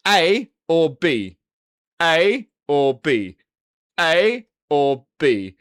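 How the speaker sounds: very slow, loud, each option set apart; deliberate presenting. Shown as a demonstration. Streamer showing viewers camera looks to compare, deliberate presenting. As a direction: lay out each choice slowly and loudly.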